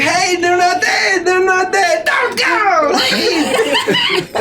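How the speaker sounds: put-on high excited yelling kids; shrill crowd of kids shouting; children screaming. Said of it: "As they're screaming".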